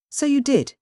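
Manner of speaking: ordinary delivery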